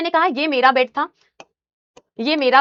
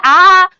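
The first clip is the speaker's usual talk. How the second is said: loud startled scream; a loud imitated shriek out of the dark; loud mock scream of fright; sudden scream from behind; 4 semitones higher, 10 dB louder than usual. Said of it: "पीछे से अचानक से आवाज आई".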